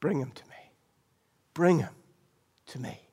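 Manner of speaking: soft, gentle call fading away; quiet tender Jesus. Acted. Jesus calling the blind man to him, soft and tender. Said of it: "what did Jesus say?".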